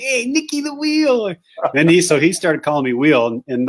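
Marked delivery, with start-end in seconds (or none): 0.0-1.3 very high, slow, sing-song greeting; imitating John. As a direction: call out his nickname with a playful lilt. John's greeting voiced in story.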